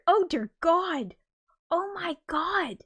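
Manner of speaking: horrified at what you just read; slow appalled gasps of God; real shock. Real, not acted. Reacting to grim chat fact.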